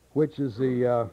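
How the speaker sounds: ordinary talk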